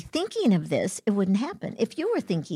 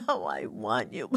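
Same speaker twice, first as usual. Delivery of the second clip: laughing, mocking a needy plea, lower; 12 semitones lower, 5 dB quieter than usual. Mocking needy pleas to the host, with a laugh. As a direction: sneer through a laugh at neediness. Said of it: "Wanty, needy has no action".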